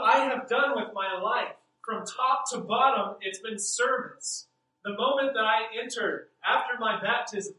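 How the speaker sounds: preaching at full force. Acted. Pastor reading Jesus's words to the church, preaching hard. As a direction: proclaim it to the whole congregation.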